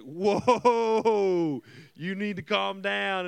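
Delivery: exaggerated alarmed "whoa"; startled. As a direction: act out recoiling from a yelling parent. Startled teen answers yelling mom.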